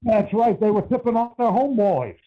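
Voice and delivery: usual speaking voice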